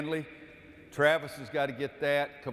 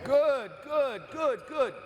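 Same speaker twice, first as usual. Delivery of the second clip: excited calling out; 14 semitones higher than usual. Real, not acted. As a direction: shout the name, then cheer the stop. Coach calling name, then praise.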